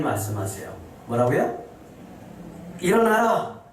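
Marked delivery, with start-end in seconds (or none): 2.8-3.6 commanding call. Acted. God's command repeated.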